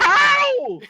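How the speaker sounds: very high, loud wail of protest; frustrated yelp. Real, not acted. Player reacts to a failed roll, pained yelp. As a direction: cry out as the roll fails you.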